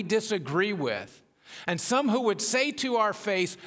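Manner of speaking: rising, fervent preacher's voice; preaching. Acted. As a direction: preach it out with building force.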